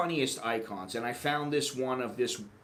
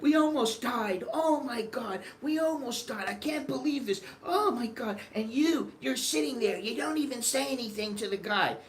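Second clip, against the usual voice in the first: hammed-up panic scolding her husband; mock hysterical mother; 10 semitones higher than usual. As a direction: carry on as if you barely survived. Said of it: "And she's like".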